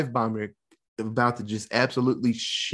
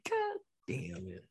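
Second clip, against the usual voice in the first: much softer, slow, deflated mutter; sad groan; 6 semitones lower, 13 dB quieter than usual. Retold or imitated. Host reacting to Gibson drowning, soft deflated mutter. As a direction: let it sink out of you. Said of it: "I was very sad about that".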